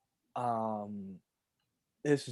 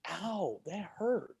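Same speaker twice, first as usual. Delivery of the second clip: put-on kid's pained whine; 6 semitones higher, slower than usual. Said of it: "I'm like".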